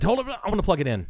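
high and fast, pushing in; jumping in before friend can plug it; eager. Retold or imitated. Eager to plug the car in himself, high and fast. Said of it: "I said".

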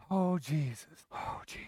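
soft, tearful sigh fading to a breath; weeping. Acted. Mary weeping for the missing Jesus, softly fading. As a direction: murmur it through tears, dying away to a whisper. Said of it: "she says she's weeping".

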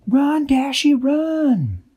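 high squeaky fairy voice, calling out; urgent warning cry. A narrator's reading. Tiny fairy yelling at her friend to flee.

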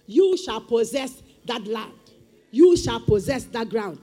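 loud preaching; preaching loudly. Real, not acted. Preacher declaring the promise to the congregation, loud. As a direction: declare it from the pulpit, loud and firm.

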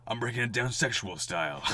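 lightly voiced crime boss; half-hearted imitation of a heavy villain; Kingpin voice. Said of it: "Kingpin's voice".

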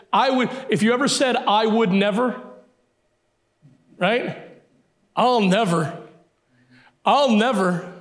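ordinary talk

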